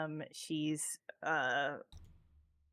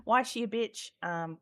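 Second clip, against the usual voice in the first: normal talking voice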